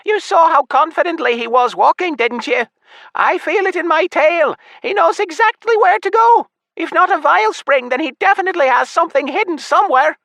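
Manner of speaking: high squeaking imp voice; squeaky imp voice. Acted. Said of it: "Tarbus the imp squeaked".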